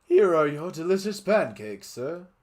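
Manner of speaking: high, loud put-on waiter. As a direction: chirp it up like an eager server. Waiter voice in fan story.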